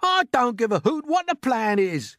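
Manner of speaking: high, loud outburst; indignant cry. A narrator's reading. Earthworm rejecting the grasshopper's suggestion, crying out. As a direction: cry out in furious refusal. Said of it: "cried the earthworm".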